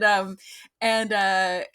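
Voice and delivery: normal talking voice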